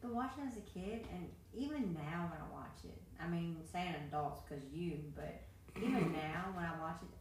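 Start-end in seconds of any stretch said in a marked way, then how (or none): none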